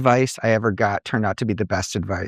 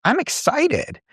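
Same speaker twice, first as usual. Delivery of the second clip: very high, bright burst on excited; reframed fear as excitement; slower than usual. Shown as a demonstration. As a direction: show the excitement in the voice. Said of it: "are you afraid or are you excited".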